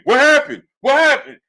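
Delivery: puzzled fan yelling; mock confused yell. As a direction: shout in confusion at the screen. Confused crowd at the fight.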